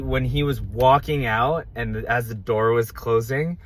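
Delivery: ordinary delivery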